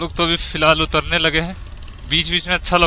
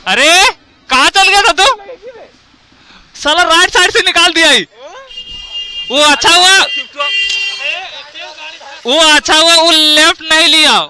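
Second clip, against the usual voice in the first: loud shouting; 12 semitones higher, 8 dB louder than usual. Real, not acted. Shouting after close call.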